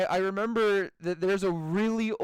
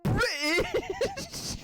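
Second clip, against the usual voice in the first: very high, drawn-out squeal; high-pitched meme impression. Retelling the British guy meme, squealed imitation. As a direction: stretch it into a squeaky mock-astonished cry. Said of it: "so high pitched at the end".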